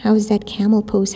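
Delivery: ordinary delivery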